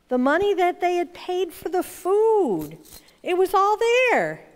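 high-pitched storyteller's astonishment; amazed discovery. A narrator's reading. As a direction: tell it as an astonishing find, pitch soaring. Narrator tells the startling discovery.

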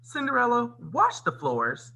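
high on the name, slow on the command; ordering. A narrator's reading. Stepmother hands Cinderella her chores, mildly commanding. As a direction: give a drawn-out storybook order. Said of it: "her stepmother ordered".

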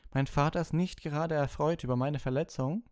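high put-on boasting complaint; smug schoolboy. A narrator's reading. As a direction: brag about father in a high voice. Malfoy boasts about his injury.

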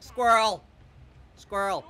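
sudden loud cry of the word; calling out. Real, not acted. Player spotting a squirrel, loud call.

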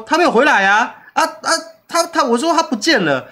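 imitating staff reporting someone has vanished; alarmed report. Staff telling the boss that the worker disappeared, with high alarm.

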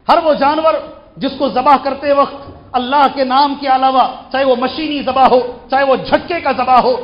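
high, loud, emphatic ruling; full-force preaching. Real, not acted. Preacher defines forbidden meat forcefully.

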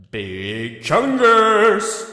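booming reveal. Acted. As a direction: announce the name like a big reveal. Announcing his Halloween costume.

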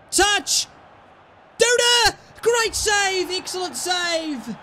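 real excitement; excited yell. Real, not acted. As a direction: yell as the keeper saves. Near miss in the match.

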